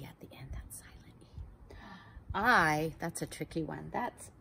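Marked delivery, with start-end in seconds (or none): 0.0-1.7 secret whisper about the silent E. Shown as a demonstration. Teacher tells kids a secret in a whisper.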